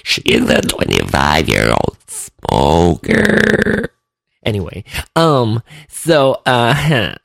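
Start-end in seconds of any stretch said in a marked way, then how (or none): 0.0-3.9 low, slow smoker's character voice; hammed-up smoky Cheryl. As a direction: sink into a lazy, deep put-on voice. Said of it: "And she sounds like this".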